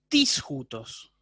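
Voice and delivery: lectura lenta y solemne de Sócrates; Sócrates preguntando. Acted. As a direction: pregunta en griego, muy despacio y marcada. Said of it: "entonces Sócrates pregunta". (profesor lee a Sócrates preguntando, lento y dramático)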